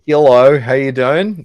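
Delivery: loud, high put-on Aussie accent; Brooke's accent. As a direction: greet with a hammed-up Aussie twang. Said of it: "This is my Brooke accent".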